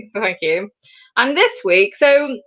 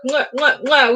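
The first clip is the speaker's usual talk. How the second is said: mwah kiss noises; blown kisses. Real, not acted. Host thanking departing guest, with blown-kiss sounds. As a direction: smack out quick spoken kisses.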